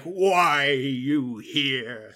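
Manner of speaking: slow, dragged-out monster voice; lumbering giant zombie growl; zombie brute. Retold or imitated. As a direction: stretch each word like a towering brute. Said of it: "towering over cities and be like".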